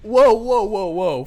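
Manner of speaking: high, loud, taken aback; mock offended whoa. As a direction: recoil in mock shock. Cabbie reacts offended.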